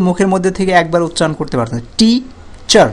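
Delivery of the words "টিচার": টিচার in two separate beats, টি then চার; higher-pitched টি, pause, then চার; split into syllables. Shown as a demonstration. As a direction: break টিচার after টি. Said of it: "দুইবার উচ্চারণ হচ্ছে টিচার".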